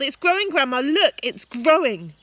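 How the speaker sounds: put-on high, loud excited boy; yelling excitedly. A narrator's reading. George yelling to Grandma that the hen grows. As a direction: call out thrilled, like a boy. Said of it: "he yelled".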